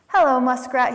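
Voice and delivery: loud, friendly storybook hail; calling out. A narrator's reading. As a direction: call a greeting across to a neighbour. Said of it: "he called".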